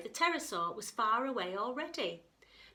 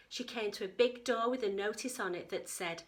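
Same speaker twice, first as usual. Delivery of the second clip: plain reading voice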